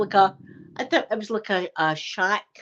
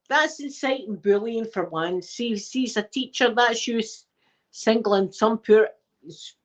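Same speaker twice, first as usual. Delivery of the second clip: usual speaking voice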